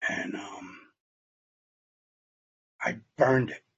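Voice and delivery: a catch of real tears, barely voiced; fighting back a sob, very soft; choked up. Real, not acted. Speaker sharing his recovery story, choked with tears. Emotional moment recalling burning money.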